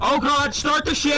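high, loud yell of alarm; panicked shout. Real, not acted. Player urges crew to take off, panicked shout. Panicked order as danger arrives.

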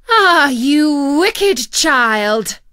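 slow, loud, furious witch cry; angry cry. A narrator's reading. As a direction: draw out the rage like a wronged witch. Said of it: "cried the enchantress".

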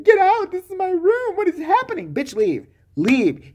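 hammed-up furious eviction; mock outrage. Wife throws strangers out of her bedroom, mock yell.

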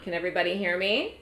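ordinary talk, nothing marked

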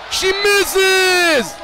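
high, shouted cry of disbelief; excited miss call. Real, not acted. Commentator seeing the game winner missed, excited high shout. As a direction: cry it out high over the court. Calls a missed winning shot.

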